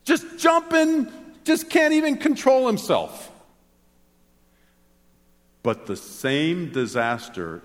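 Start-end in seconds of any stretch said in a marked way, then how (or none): 0.0-3.3 happy Snoopy. Pretend. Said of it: "Snoopy when Snoopy's happy".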